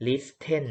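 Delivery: lis-ten, a hard aspirated t in "listen"; T sounded. Shown as a demonstration. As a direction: show the mistake: "listen" with its t heard. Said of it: "ไม่ออกเนาะ ตัว T ไม่ออกเสียง".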